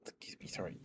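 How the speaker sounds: whispered apology; whispering. Real, not acted. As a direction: whisper the excuse me. Quiet aside while streaming.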